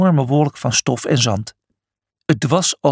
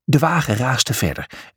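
usual speaking voice

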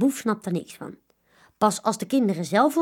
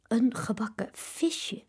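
alarmed whisper; 5 dB quieter, slower than usual. A narrator's reading. Children alarmed at fried fish.

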